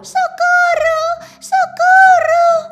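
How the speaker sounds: very loud, high, drawn-out screams for help; cry for help. Acted. The mouse screams for help as the cat tries to eat her, very loud and drawn out.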